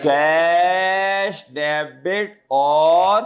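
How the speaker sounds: drawn-out prompt. Shown as a demonstration. Teacher draws out the entry for students to finish, slowly.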